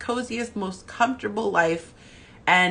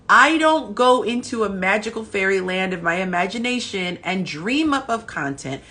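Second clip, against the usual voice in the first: normal talking voice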